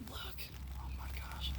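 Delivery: amazed whisper. Real, not acted. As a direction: breathe it out in awe. Amazed inside the tent.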